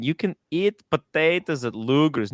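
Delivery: ordinary talk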